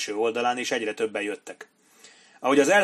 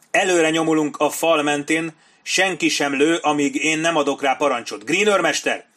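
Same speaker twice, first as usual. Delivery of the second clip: high, loud officer's commands; forceful raised battle voice; shouted orders; 5 semitones higher, 6 dB louder than usual. A narrator's reading. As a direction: call orders down the line. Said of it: "kiáltotta Sharp".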